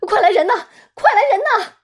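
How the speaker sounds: high, loud cry for help; shouting for help. Retold or imitated. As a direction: yell for help across the building. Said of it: "大喊道".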